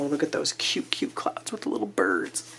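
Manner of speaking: soft whisper, melting over cute things; hushed and adoring; gushing whisper. Real, not acted. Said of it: "I'm sorry to go freak show on you".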